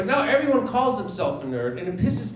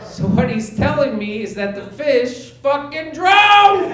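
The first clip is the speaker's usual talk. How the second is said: louder, slow, laughing outburst; outraged disbelief; 6 semitones higher, 8 dB louder, slower than usual. Real, not acted. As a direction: hammer the absurd punchline. Punchline outburst.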